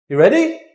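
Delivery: ready? goes up high and loud; rising question. Shown as a demonstration. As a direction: lift ready? up like a real question.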